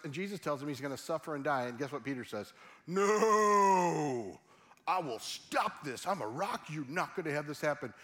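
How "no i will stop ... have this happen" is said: long drawn-out, booming "no"; mock-heroic outburst, loud then easing off; dramatic protest. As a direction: wail a huge no in hammed-up defiance. Acting out Peter's outburst at Jesus.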